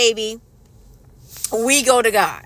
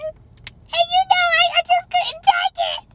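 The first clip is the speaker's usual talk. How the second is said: overcome. Real, not acted. Mother melting at her crying son, voice soaring high. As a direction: voice going way up, overwhelmed by feeling.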